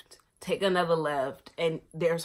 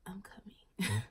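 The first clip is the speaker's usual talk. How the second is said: mom whispering I'm coming; soft, voiceless reassurance; whispered reply; 5 dB quieter, slower than usual. Retold or imitated. Sleepy mom answering her daughter from bed, whispering.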